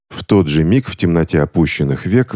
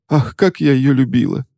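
mock sob; 9 semitones higher than usual. A narrator's reading. Witch fake-sobbing about her aunt to the clerk. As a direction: sob out the words for show. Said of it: "всхлипнула Ремора".

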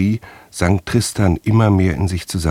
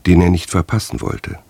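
ordinary delivery

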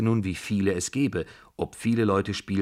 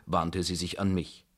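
ordinary delivery

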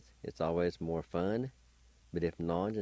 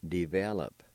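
ordinary talk

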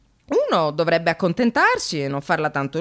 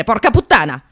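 sudden curse; 3 semitones higher, 9 dB louder than usual. Real, not acted. Sudden outburst at the agency.

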